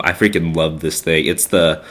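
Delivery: ordinary talk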